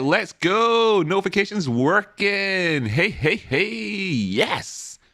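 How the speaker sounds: high, drawn-out celebratory whooping; excited cheer. Real, not acted. Host celebrating working Discord notifications, excited cheer. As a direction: cheer it out high, stretching the words.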